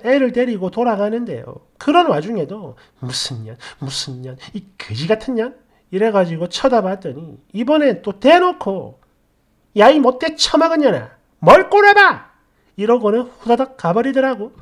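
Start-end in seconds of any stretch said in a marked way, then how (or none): 3.0-5.6 muttered insults. Retold or imitated. Mother mutters insults leaving. 9.8-12.3 yelled insult. Retold or imitated. Mother yells insult, flees.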